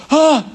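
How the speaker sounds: high, loud mock-panic "Ah!"; mock shriek. Seder guests seeing chametz, a mock panicked shriek. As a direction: shriek at the bottle like the family did. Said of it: "everyone's like".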